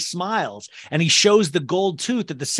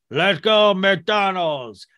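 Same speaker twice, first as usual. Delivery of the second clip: imitating grandpa's slurred stroke speech; 2 semitones higher, much slower than usual. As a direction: do grandpa's voice after his stroke. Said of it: "stroke voice".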